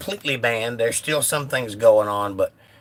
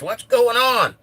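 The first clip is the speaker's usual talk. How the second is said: high, loud mock-outraged question; mock exasperation; 11 semitones higher, 5 dB louder, slower than usual. Streamer voicing a viewer upset about the union, mock exasperation. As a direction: cry it out in put-on exasperation.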